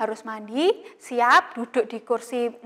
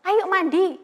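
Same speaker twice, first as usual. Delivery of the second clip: mom calling; 7 semitones higher, slower than usual. Retold or imitated. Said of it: "kalau misalnya Mama suruh".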